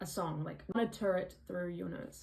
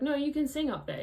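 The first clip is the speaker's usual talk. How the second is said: higher, louder quoted voice; coach's encouraging correction; 7 semitones higher, 5 dB louder than usual. Retold or imitated. Coach to singer who gave up, encouraging correction. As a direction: cut in with an insistent correction.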